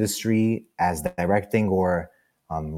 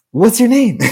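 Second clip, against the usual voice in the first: high, loud, overfriendly hello; imitating your own keen, high-pitched small talk; eager greeting; 13 semitones higher, 7 dB louder than usual. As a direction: chirp it brightly to make friends. Retells own eager greeting to rival.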